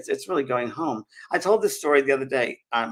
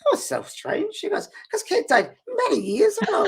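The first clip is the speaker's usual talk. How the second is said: high-pitched put-on Scottish accent; Mary, Scottish lady. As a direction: imitate a confused Scottish woman, high up. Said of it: "I'm trying to my Scottish accent".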